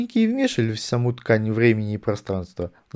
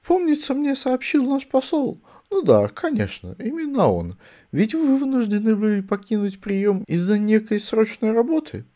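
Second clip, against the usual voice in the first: sly prince voice; 11 semitones higher than usual. A narrator's reading. Prince speaks to Vimes.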